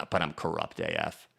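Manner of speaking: mock a sweet granny admitting corruption. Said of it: "She tries to talk like your sweet granny".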